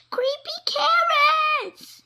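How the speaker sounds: high, loud, slow terrified shout. Acted. Rabbit boy shouting at the carrots on his wall. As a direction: yell it out in terror. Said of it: "he shouted".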